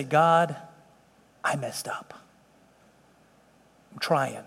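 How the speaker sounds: low, near-whispered prayer; acted penitent murmur with long pauses; hushed confession. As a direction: confess haltingly to God, very softly. Acts out confessing to God.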